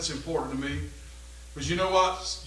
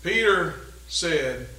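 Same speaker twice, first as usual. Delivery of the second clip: ordinary delivery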